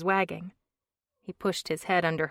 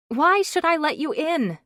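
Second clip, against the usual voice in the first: put-on young woman voice; 10 semitones higher, 8 dB louder than usual. A narrator's reading. Narrator voices Brooke, suspicious.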